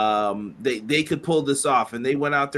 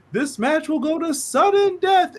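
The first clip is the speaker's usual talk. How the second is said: high booming official announcement; Gorilla Monsoon; 12 semitones higher, 3 dB louder than usual. Retold or imitated. President announcing overtime to the arena, hammed-up proclamation. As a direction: proclaim it like the league president at ringside. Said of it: "Here comes Gorilla Monsoon".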